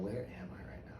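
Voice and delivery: hushed, bewildered aside; whispered inner thought. Retold or imitated. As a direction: whisper it to yourself, puzzled. Retold bewildered thought in waiting room.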